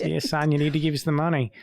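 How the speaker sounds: nasal Australian accent from back of throat. Shown as a demonstration. Host showing guest his impression of the tax office, Australian accent. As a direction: demonstrate a nasally Aussie taxman. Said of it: "I'm doing quite a nasally Australian accent".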